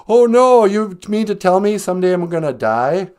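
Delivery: loud, high hammed-up dismay; exaggerated cry of disbelief; mock horrified Scrooge. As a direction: burst out in comic alarm. Scrooge shown his own gravestone.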